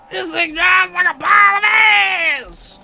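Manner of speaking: hammed-up furious grandpa yell, dragging the words. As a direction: rant like a bitter, vengeful seventy-year-old grandpa.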